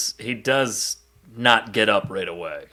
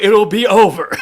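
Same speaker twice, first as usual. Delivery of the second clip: laughing; 8 semitones higher, 6 dB louder than usual. Retold or imitated. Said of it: "He's like".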